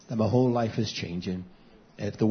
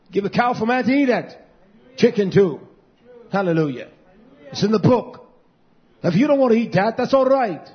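high, loud preacher's delivery; full-force preaching; 8 semitones higher, 6 dB louder than usual. Real, not acted. Preacher arguing the dietary law to the congregation, full-force preaching. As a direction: preach it high and hard, punching the phrases.

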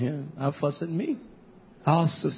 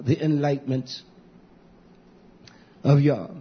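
solemn slow reading; 2 semitones higher, 3 dB louder, slower than usual. Acted.

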